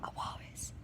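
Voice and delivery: whispered prompt. Real, not acted. Child secretly prompting friend, whisper. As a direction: whisper the answer to your friend.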